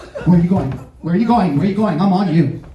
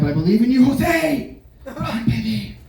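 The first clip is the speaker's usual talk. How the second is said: hopeful gambler; 6 semitones higher than usual. Retold or imitated. Gambler pleading to player.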